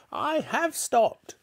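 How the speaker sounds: put-on very high, slow old-woman squawk; grandma. A narrator's reading. Grandma answering George from the roof, screechy. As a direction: answer back in a piercing granny voice. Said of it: "she answered".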